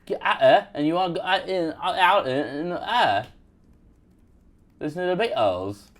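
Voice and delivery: exaggerated, garbled Cockney chat-up; vowels-only Cockney. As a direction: swallow every consonant, Cockney style. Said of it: "only say the vowels".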